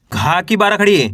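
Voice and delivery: normal talking voice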